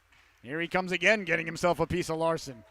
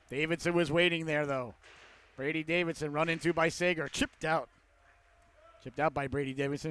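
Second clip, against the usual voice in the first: usual speaking voice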